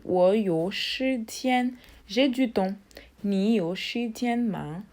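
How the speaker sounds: ordinary talk, nothing marked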